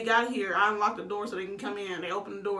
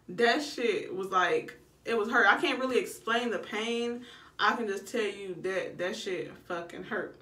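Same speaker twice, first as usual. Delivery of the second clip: normal talking voice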